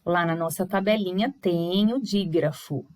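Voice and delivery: ordinary talk, nothing marked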